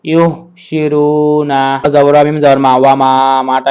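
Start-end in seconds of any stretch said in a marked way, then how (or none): 0.0-1.6 ইউহশিরুনা very slow, the long vowel drawn out; stretched vowel. Shown as a demonstration. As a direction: stretch the a of ইউহশিরুনা one alif. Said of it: "এক আলিফ টেনে পড়তে হবে".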